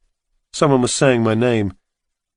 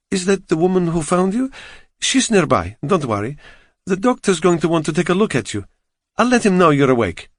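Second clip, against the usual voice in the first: put-on a light Eastern European accent, higher, kindly nurse; 7 semitones higher than usual. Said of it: "She had an Eastern European accent".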